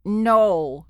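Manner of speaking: loud, sharp creature refusal. A narrator's reading. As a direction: bark it out as the creature. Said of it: "said the creature".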